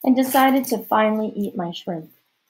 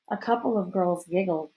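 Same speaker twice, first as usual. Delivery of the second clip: ordinary delivery, nothing marked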